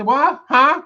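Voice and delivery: high, loud "what? huh?"; baffled customers. Retold or imitated. Imitating people who come in and can't understand him, loudly baffled. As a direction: exaggerate failing to understand. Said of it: "they come in and say".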